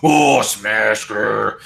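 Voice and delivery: slow, drawn-out orc voice; orc talk. Retold or imitated. Vulcan lapsing into orc speech, slow orc voice. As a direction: stretch it out like a dim orc. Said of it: "He starts talking like an orc".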